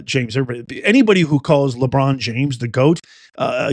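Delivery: usual speaking voice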